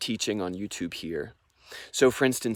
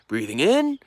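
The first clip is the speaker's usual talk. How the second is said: high, louder cue with a lifted ending; Wim Hof's breathing cue; 8 semitones higher, 4 dB louder than usual. Retold or imitated. Breathing teacher cueing students to inhale, imitated instructor's call. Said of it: "So you'll hear him say".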